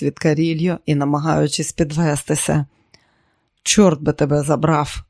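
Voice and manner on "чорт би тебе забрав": plain reading voice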